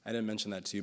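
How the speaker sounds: usual speaking voice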